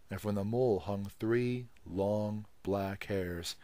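ordinary delivery

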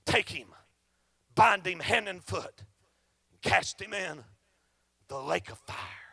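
lightly voiced judging God; stern voice of God. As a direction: pronounce the sentence low and grave. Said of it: "The moment that God says".

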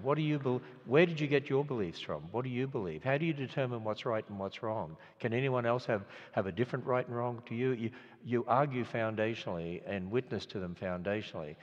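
usual speaking voice

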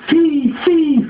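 very high shrill bird voice; owl hiss. A narrator's reading. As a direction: screech it. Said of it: "faisait-elle".

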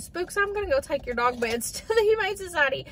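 high, gentle voice breaking the news to the dog with a laugh; pretend telling the dog. Owner telling her dog Spooks his beds are going, gently laughing. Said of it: "I just feel like if I said".

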